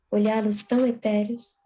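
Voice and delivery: usual speaking voice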